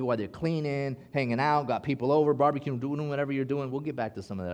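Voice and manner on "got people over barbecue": ordinary talk, nothing marked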